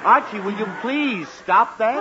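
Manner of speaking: exasperated father losing patience. Acted. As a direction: plead crossly for it to stop. Father scolds son for yawning.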